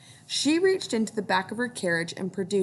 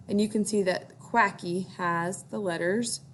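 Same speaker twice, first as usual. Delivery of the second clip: ordinary delivery, nothing marked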